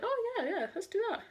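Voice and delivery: high-pitched mock-eager chorus; eager agreement. Retelling group's eager agreement.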